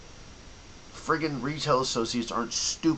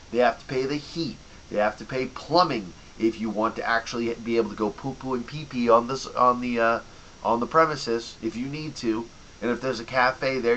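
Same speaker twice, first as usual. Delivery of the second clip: ordinary talk, nothing marked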